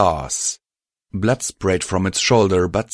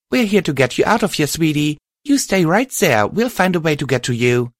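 high, quick character voice; high-pitched, urgent comforting; heroine reassuring a filly; 9 semitones higher, 4 dB louder than usual. Acted. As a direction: reassure the trapped little one from afar.

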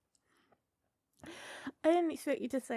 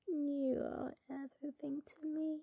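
ordinary delivery

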